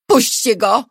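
high, loud demand; crying out. A narrator's reading. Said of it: "krzyknęła Elżbieta".

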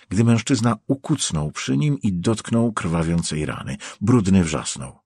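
usual speaking voice

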